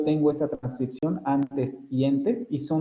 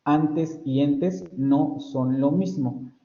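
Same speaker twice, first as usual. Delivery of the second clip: very slow, stretching "antes" and "entes"; very slow contrast demo; much slower than usual. Shown as a demonstration. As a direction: spell out "antes" against "entes" at half speed. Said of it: "sigo percibiendo la distintividad".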